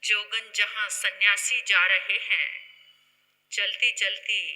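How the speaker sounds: normal talking voice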